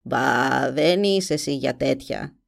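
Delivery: curt, dismissive witch; abrupt. A narrator's reading. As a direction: brush her off sharply. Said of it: "είπε απότομα". (απότομα)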